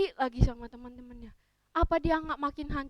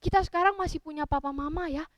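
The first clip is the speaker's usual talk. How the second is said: plain reading voice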